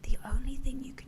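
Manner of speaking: unvoiced whisper. Shown as a demonstration. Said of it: "kind of like this".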